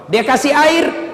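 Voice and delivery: usual speaking voice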